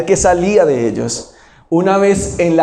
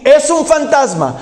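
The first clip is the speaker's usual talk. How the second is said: grito alto de miedo fingido; asustados; 8 semitones higher than usual. Retold or imitated. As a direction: imita a los discípulos asustados. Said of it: "ellos estaban diciendo aquí". (voz alta y asustada de discípulo)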